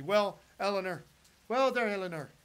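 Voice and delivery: rough, half-managed Irish lilt; Irish accent. Acted. Greeting Eleanor from Ireland in a shaky attempt at an Irish accent. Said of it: "I can't do an Irish accent that well".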